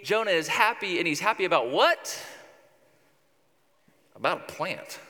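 ordinary talk

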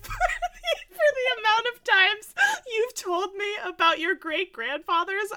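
talking through high laughter; laughing. Real, not acted. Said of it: "laughing while speaking".

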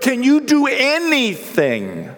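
high, slow, pleading cry; crying plea. Acted. Father begging Jesus to heal his son, high tearful plea. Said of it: "And he's crying".

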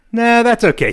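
mother-in-law voice. Retold or imitated. Mother-in-law quote, high loud.

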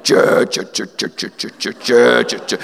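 guttural mock metal growl; imitating a metal band's growl; sludge metal growl. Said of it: "there's, like, a sludge metal band below you".

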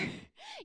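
high bright burst of laughter. Real, not acted. Preacher laughs while urging the crowd, quick high laugh. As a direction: break into a laugh.

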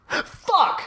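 high, unvoiced squeak of pain; a tiny pained yelp; blob's pained yelp. Punched blob crying out, high unvoiced squeak. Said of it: "it shouts".

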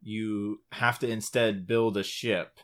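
normal talking voice

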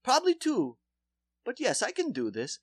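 put-on solemn promise in Polish accent. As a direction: dentist pledging the root canal in accent. Dentist voiced.

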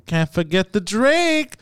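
slow, high Brooklyn bodega guy; Brooklyn accent. Retold or imitated. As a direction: drawl it like a Brooklyn deli man. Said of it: "like a Brooklyn accent".

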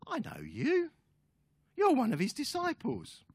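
high-pitched mock girl's voice; servant girl. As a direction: accuse in a put-on high voice. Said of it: "this little servant girl".

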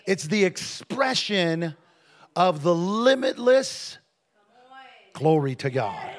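slow, swelling preacher's build; drawn-out preaching crescendo; weighty emphasis. Real, not acted. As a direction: stretch each phrase, rising in force.

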